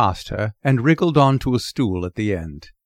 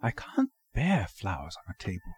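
softer, half to herself; murmured; 3 semitones lower, 9 dB quieter than usual. A narrator's reading. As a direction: mutter it under her breath with distaste. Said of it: "I heard her murmur".

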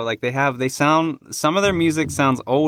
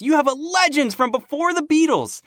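high, rising excitement; excited; 11 semitones higher than usual. Real, not acted. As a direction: gush with amazement. Building excitement about [name].

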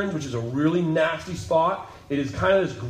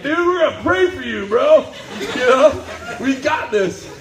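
bro chaplain; 10 semitones higher, 6 dB louder than usual. Retold or imitated.

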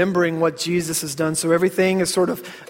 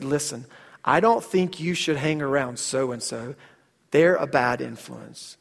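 low, soft, measured parental talk; sit the child down and caution quietly; warning parent; 5 semitones lower, 5 dB quieter than usual. Retold or imitated.

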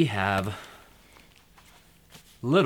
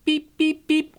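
high, loud little horn beeps; urgent beeping; 7 dB louder than usual. Acted. Little Blue Truck stuck in mud, high urgent beeps. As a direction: beep for help like a small truck.

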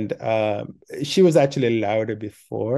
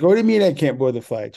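loud; 4 semitones higher, 6 dB louder, faster than usual. Retold or imitated. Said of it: "She was kinda loud".

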